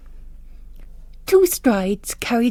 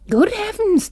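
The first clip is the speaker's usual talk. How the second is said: much higher exclamation of shock; mock astonishment; 12 semitones higher than usual. Bishop hearing about Netherhorton, mock-shocked high exclaim. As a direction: gasp it out in feigned horror.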